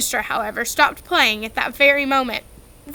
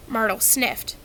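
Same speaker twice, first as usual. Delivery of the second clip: usual speaking voice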